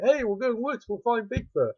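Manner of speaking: put-on eager teenagers. Teens eager to hunt Bigfoot.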